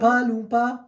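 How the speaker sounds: high-pitched regional British catchphrase accent; imitated catchphrase accent. Shown as a demonstration. Showing an accent he can manage, high imitated accent. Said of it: "is the only one".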